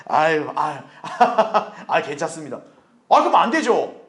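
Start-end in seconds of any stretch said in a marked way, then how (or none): 0.1-2.6 chuckling, mock bashful brush-off; awkward refusal. Believer declining the Lord's offer, awkward laughing refusal.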